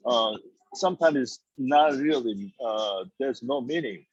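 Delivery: ordinary talk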